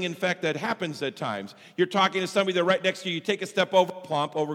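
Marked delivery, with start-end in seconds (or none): none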